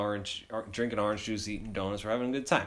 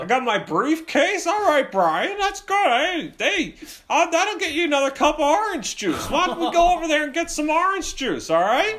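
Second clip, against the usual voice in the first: high, loud dad imitation; placating dad; 5 dB louder than usual. Dad steering son toward orange juice, high hammed-up placating. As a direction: move your kid along in a put-on dad voice.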